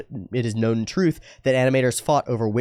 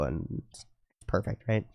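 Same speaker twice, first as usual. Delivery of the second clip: usual speaking voice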